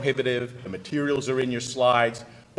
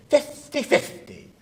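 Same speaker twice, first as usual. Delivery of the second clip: high, slow put-on Spanish accent; 11 semitones higher, slower than usual. Lawyer giving a client the odds, mock Spanish accent. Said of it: "I'll give you a Spanish accent".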